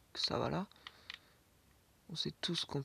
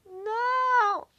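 cri très aigu et fort; cri de désespoir; 13 dB louder than usual. Real, not acted. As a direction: hurler en mourant. Meurt en jeu. (hurlement aigu)

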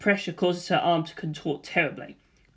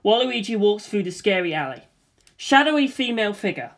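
usual speaking voice

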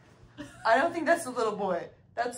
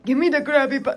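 higher, louder cartoon villain attempt; Plankton; 5 semitones higher, 8 dB louder than usual. Playing a cartoon villain to friends, high mock villain voice. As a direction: do a punchy cartoon villain demand. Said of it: "I feel like ... plankton".

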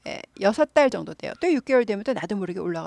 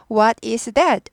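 What is that with a slow falling end; falling question; 5 dB louder, much slower than usual. Shown as a demonstration. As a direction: drop the tune on "that". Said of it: "하고 내려서 물어봅니다".

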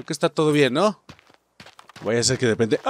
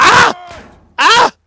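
sharp high yelps of pain; loud shocked scream; 11 dB louder than usual. Real, not acted. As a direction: scream out as you get hit. Gets hit in game.

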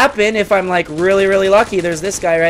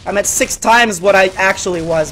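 ordinary talk, nothing marked

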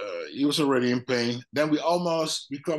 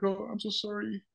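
a high, soft, over-sorry mock apology; mock American apology; 7 semitones higher, 6 dB quieter than usual. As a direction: ham up a fake public apology. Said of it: "like, a really American".

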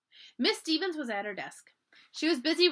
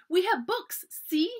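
high, louder, proud showing-off; excited little girl; 8 semitones higher, 6 dB louder, slower than usual. A narrator's reading. Small sister holding up her school books, excited. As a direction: chirp it high like an eager child. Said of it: "began jumping up and down".

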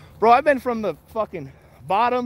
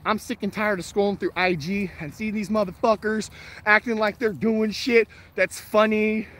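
ordinary delivery

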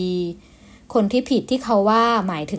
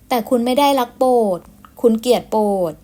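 a touch of tearful strain; hurt, holding back tears; mild tearful reproach; slight sob; 3 semitones higher, 3 dB louder than usual. A narrator's reading. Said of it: "หล่อนพูดพร้อมกับเสียงสะอื้นเล็กน้อย".

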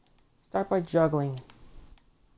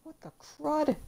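surprised yelp; 10 semitones higher than usual. Real, not acted. Frustrated gamer exclaims.

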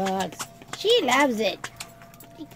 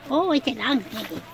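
ordinary delivery

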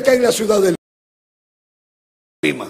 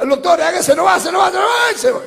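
shouted and climbing much higher; retold shout; 9 semitones higher, 3 dB louder than usual. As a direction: cry out to the doctor in alarm. Pastor retells his shout in surgery. (grito alarmado al doctor)

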